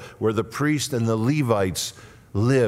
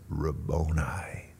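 low, slow, awed whisper; hushed breath of devotion; endearing whisper; 6 semitones lower, 7 dB quieter, slower than usual. Acted. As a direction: whisper it lovingly, drawn out. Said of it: "it's an endearing term".

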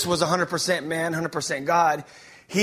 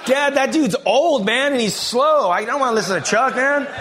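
put-on whiny teen; 7 semitones higher, 4 dB louder than usual. Teen son whining to dad.

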